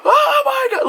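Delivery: high, drawn-out frightened cry; panicked screaming. Retold or imitated. Navigator in the car screams at the deer. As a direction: shriek in terror as the deer leaps. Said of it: "Just screaming".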